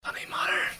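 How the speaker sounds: breathy whisper with no voice; mock deathbed whisper; whispered dismissal. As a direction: wave it away in a dying whisper. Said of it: "And I was like".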